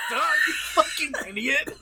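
loud, high mock scolding; laughing exasperation. Viewer mocking the character's disclaimer, laughing exasperation. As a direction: yell it incredulously through laughter.

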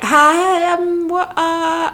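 high, stretched-out hesitant stammer; mock bafflement. Imitating friends baffled by her text, high hesitant voice. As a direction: imitate someone lost for a reply. Acts out baffled message sender.

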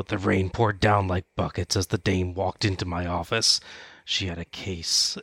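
a touch of noir detective monologue. Retold or imitated. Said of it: "the film noir detective's opening monologue".

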